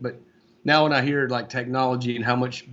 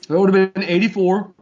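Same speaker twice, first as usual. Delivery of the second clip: talking through a mouthful of pizza; chewing while answering; mouth full; 7 semitones higher, 5 dB louder than usual. Real, not acted. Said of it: "Sorry, I got some pizza in my mouth".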